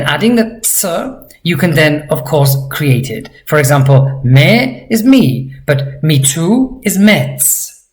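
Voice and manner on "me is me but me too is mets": usual speaking voice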